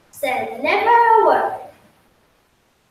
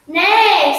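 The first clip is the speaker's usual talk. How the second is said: whinny like a horse; horse neigh; 3 semitones higher, 4 dB louder than usual. Acted. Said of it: "said the horse".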